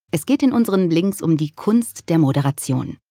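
normal talking voice

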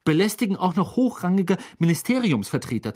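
high, affronted complaint; hissing indignation. A narrator's reading. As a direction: scold the brothers' cheek officiously. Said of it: "zischte Percy".

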